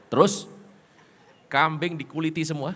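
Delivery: ordinary delivery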